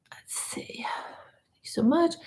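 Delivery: very soft, breath-only whisper; whispered aside. Real, not acted. Host checking comments and thanking someone, whispering. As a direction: whisper it as if to yourself. Murmuring while reading chat comments.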